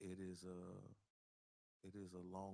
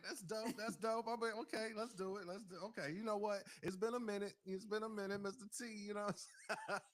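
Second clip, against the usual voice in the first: higher and louder, fired up and laughing; hyped; 12 semitones higher, 7 dB louder than usual. Real, not acted. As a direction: really pump up the excitement at being interviewed. Hyped about being interviewed.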